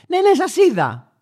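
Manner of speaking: high, slow, drawn-out brush-off; dismissive woman. Retold or imitated. Woman in the car dismisses the honking driver, slow and high. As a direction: wave him off like he's a nuisance. Woman admits seeing him.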